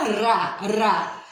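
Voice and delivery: ra said with a trilled R; strong rolled R. Shown as a demonstration. Teacher showing children the R sound, trilled. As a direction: roll the tongue on ra, ra. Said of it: "você vê a pronúncia que o R, né, fala".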